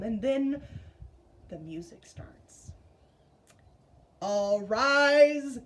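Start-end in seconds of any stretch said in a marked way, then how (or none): 4.2-5.6 a hint of a booming preacher, much louder; sonorous preacher call. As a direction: call the church to its feet. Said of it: "comes the sonorous voice".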